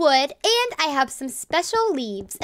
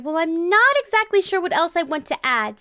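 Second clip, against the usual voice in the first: ordinary talk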